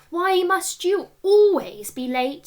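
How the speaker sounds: high, loud and slow exasperated question; teacher scolding the late boy, slow and exasperated. A narrator's reading. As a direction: drag it out, fed up with him.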